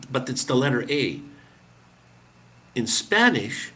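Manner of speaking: ordinary talk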